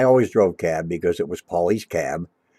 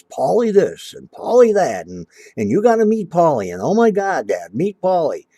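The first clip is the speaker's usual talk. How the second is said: higher, louder mock of a gushing teen daughter; a father lampooning his excited girl; mimicking gushing daughter; 8 semitones higher, 4 dB louder than usual.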